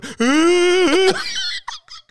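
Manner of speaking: very high fake wail; mock crying. Comic answering a partner's love question, mock crying. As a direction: burst into pretend bawling. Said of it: "And I just start crying".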